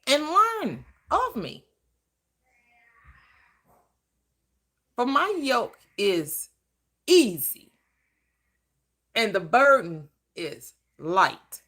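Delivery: slow, weighty scripture delivery; slow scripture reading. Acted. Preacher reading Christ's words to the church, slow. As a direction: let each phrase land slowly. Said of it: "the script says".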